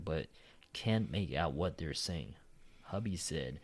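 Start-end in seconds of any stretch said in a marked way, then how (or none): none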